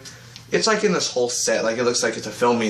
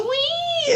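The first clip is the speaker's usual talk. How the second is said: very high, piercing squeal; mock canary cry. Reviewer demonstrating Canary's battle cry, shrieking high. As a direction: imitate a sonic screech in falsetto.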